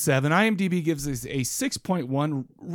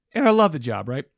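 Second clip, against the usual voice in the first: usual speaking voice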